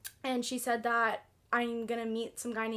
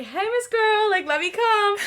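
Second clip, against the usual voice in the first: eager retold message; 10 semitones higher, 9 dB louder, slower than usual. Acts out her eager text message.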